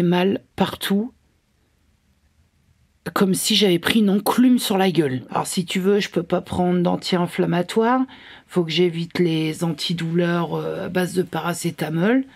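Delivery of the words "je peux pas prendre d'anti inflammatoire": usual speaking voice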